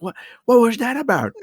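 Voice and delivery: high suspicious question; pry like a wary mother; mock mom demanding an answer; imitating mom. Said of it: "then she's like".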